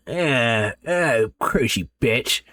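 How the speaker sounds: lightly voiced croaky old man, slow; sick old man. A narrator's reading. Bedridden grandfather grumbles at the nurse, lightly voiced. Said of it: "old sick man laying in a bed going".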